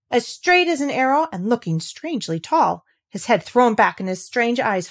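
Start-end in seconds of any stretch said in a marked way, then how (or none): none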